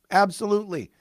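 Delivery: ordinary talk, nothing marked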